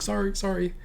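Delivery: high, small imitated voice; high-pitched apology after being shushed; sheepish apology. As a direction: squeak out a meek apology. Acting the shushed mage apologizing.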